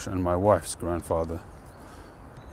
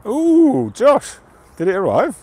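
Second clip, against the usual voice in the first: very high, loud, squealing ooh; real excitement over a friend's delivery; excited squeal; 11 dB louder, slower than usual. Real, not acted. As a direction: squeal with delight for a parcel. Excited about a viewer's delivery.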